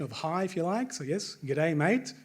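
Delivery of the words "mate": ordinary talk, nothing marked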